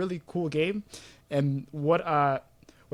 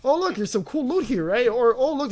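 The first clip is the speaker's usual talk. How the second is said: higher, louder, distracted and eager; excited gamer; 8 semitones higher, 3 dB louder than usual. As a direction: get sidetracked by every shiny thing. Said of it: "'cause it's like".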